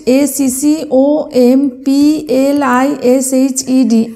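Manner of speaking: slow, separate letters; dictating the spelling slowly. Shown as a demonstration. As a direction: spell it out one letter at a time. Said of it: "আমি দেখো তোমাদের উচ্চারণ করে ভেঙে ভেঙে".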